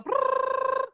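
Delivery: high, slow buzzing brrrr sound; a buzzing brrrr, drawn out high like a stalled brain; brain whirring noise. Retold or imitated. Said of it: "our brains just go".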